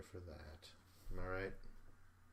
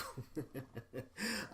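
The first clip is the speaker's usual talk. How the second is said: laugh; 5 semitones higher, 5 dB quieter than usual. Real, not acted. Laughs at his reaction.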